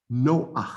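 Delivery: Noach as No-ach, the a before the final guttural; stolen patach demonstration. Shown as a demonstration. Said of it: "It's between the Nun and the Chet".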